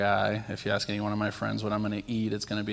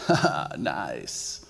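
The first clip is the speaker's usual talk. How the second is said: guy's mocking remark; 6 semitones lower, much slower than usual. Imitating the guy behind him.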